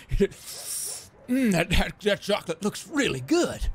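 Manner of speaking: high, stammering fake admiration; hammed-up dopey boss; goofy CEO. Plays the CEO admiring the chocolate.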